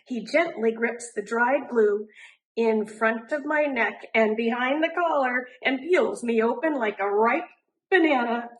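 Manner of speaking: plain reading voice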